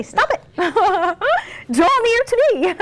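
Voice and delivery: a hint of a divine voice, drawn out; voicing God. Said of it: "he's gonna say".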